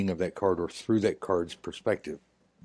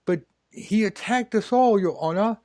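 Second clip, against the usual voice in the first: high whiny mock thug pleading; whining thugs; 10 semitones higher than usual. Thugs pleading with the judge, high mock whine. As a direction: play the innocent to the judge, high and wheedling. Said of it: "And they're like".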